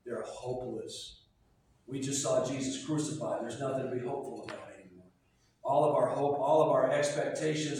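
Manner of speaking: low, subdued voice of defeated followers; hushed, deflated lament for lost hope; sad, hopeless. Acted. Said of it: "what's their state? ... They're sad. They're hopeless".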